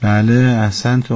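ordinary delivery

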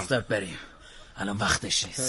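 low, soft order to leave; quiet retreat. Acted. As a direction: call your man off under the voice. Boss quietly calls henchman off. (آرام و با صدای پایین)

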